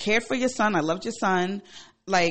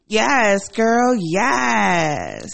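loud, drawn-out triumphant yes; triumphant cheer; 4 semitones higher, 8 dB louder, much slower than usual. Real, not acted. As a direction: holler it like cheering on a friend. Cheering her own comeback.

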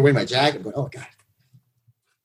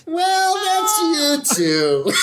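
higher, slow, laughing; laughing through words; 13 semitones higher, much slower than usual. Real, not acted. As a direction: draw it out while laughing. Riffing catchphrase, laughing.

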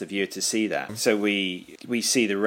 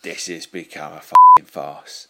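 fed-up promoter; 5 semitones lower, 11 dB louder than usual. Retold or imitated. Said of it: "And he's just like".

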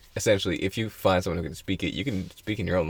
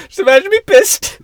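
much higher, louder, laughing squeal; high laughing squeak; 11 dB louder than usual. Acted. Showing how high the voice gets when angry, with laughter. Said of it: "My voice gets higher".

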